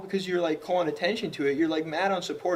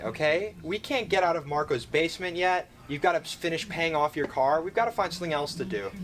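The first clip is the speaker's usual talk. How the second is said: normal talking voice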